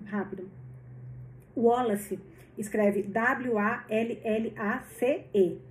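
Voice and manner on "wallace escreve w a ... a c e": slow letter by letter: W A L L A C E; spelling it out. Shown as a demonstration. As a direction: spell Wallace slowly, each letter apart. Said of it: "Às vezes eu falo muito rápido".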